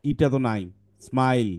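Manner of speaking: ordinary talk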